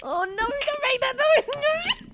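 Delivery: high, drawn-out wail of protest; distressed wail. Pretend. Co-host begging him not to make that noise, distressed wail.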